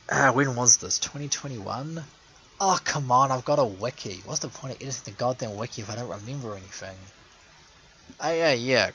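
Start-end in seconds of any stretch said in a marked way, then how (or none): none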